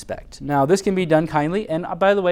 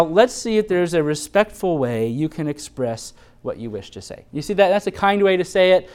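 ordinary talk, nothing marked

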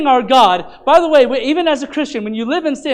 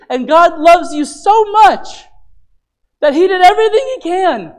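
fervent preaching; 3 semitones higher, 3 dB louder than usual. Acted. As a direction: build the climax from the pulpit.

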